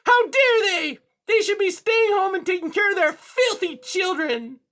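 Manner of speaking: high, loud indignant villain; shrieky scandalized cat; outraged cat. A narrator's reading. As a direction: rail furiously in a piercing high voice. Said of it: "Silversides cried".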